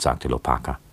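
ordinary delivery

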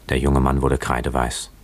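ordinary delivery

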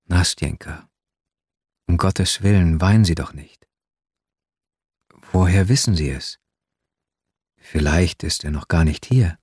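low-pitched gentle pleading; coaxing, soothing. A narrator's reading. Narrator begs weeping Nastjenka not to cry, low and coaxing. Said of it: "mit leiser, einschmeichelnder Stimme".

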